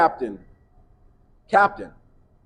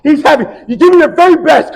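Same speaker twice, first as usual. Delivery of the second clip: very loud, high shouted push; coach yelling; 13 semitones higher, 17 dB louder than usual. Retold or imitated. Coach to Brock, loud high yelling. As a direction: drive him forward with a yell.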